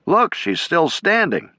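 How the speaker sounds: high, slow call across the haze; yelled. A narrator's reading. Soldier yelling to his men that the bridge still stands. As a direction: shout it out, pointing at the bridge.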